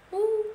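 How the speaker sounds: high put-on moo; cow moo. Cows typing in the barn, high put-on moo. Cows mooing amid the clicks.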